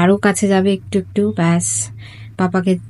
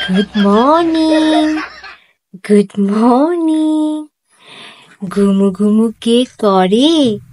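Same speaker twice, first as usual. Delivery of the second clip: high, slow sing-song pet talk; 5 semitones higher, slower than usual. Real, not acted. Mother cooing good morning to her pet, high baby talk.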